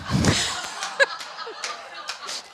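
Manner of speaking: airy, high silent laugh; real breathy laugh at her own botched accent; breathless laugh. Real, not acted. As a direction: laugh at yourself, barely voiced.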